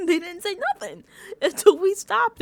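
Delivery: can't get it out without cracking up; laughing. Real, not acted. To the viewers, retelling the funny mat moment, laughing.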